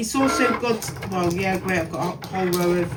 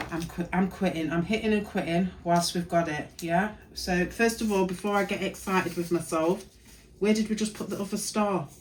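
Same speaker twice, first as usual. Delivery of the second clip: ordinary talk, nothing marked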